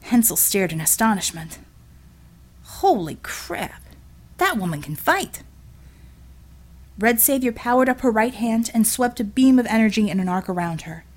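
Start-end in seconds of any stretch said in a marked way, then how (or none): 2.6-5.4 slow, stunned working man's voice; a lightly voiced union man gaping at a fight; astonished Brooklyn guy. Hensel's character voice.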